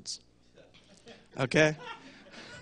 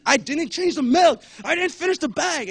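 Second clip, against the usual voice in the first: much higher, louder, slowed family yell; mock screaming; 12 semitones higher, 6 dB louder than usual. Family member yelling about the milk in a European household, mock scream. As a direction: scream it like a fight over the milk. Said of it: "Why are you screaming".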